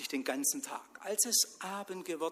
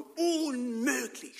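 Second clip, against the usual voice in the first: high, slow, appalled wail; horrified cry; 11 semitones higher, 5 dB louder, much slower than usual. Acted. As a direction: cry it out in horror at the mess. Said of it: "schrie er". (Mann schreit entsetzt, hoch und langsam)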